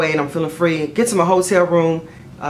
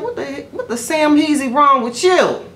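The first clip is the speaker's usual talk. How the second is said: high, incredulous mock outrage; the dog asking what's wrong with her, in a high mock-incredulous voice; dog's disgusted look; 9 semitones higher than usual. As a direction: voice the dog judging her, pitched up. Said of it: "She looked at me like".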